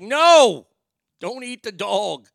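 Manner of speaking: high, loud scolding shout, drawn out; retold yell. Owner retelling how he stopped Bubba snapping, mock scolding yell. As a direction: re-enact yelling a dog off. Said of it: "I yelled at Bubba".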